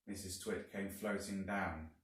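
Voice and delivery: ordinary delivery, nothing marked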